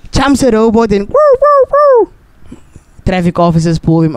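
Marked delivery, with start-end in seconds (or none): 1.4-2.1 high woo-woo siren imitation; siren noise. Telling how the Pope jumps a robot, a high siren imitation. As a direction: whoop it like a police siren, very high.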